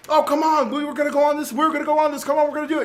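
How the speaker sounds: loud, high, excited movie-star coaxing; Will Smith. Retold or imitated. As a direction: imitate an eager husband egging everyone on. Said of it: "was your Will Smith impression".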